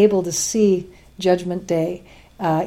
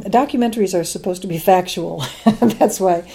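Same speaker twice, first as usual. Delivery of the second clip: normal talking voice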